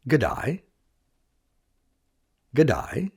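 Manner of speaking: Australian greeting G'day with a lifted end; a friend from Australia greeting you in Australian. Shown as a demonstration. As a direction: say G'day like an Aussie mate. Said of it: "If your friend is from Australia".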